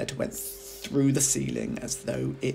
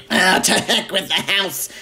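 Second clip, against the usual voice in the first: put-on old screechy voice; 8 semitones higher, 11 dB louder than usual. A narrator's reading. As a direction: crow it down from the attic like a witch. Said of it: "she shouted".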